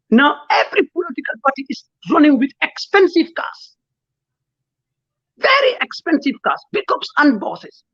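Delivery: crying through the words, voice breaking; real tears while condemning the politicians' cars; emotional, crying. Real, not acted. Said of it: "I'm a bit emotional".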